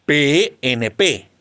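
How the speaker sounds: P N P read slowly, each letter named apart; letters spelled out. Shown as a demonstration. Teacher giving the class a second acronym, spelled slowly.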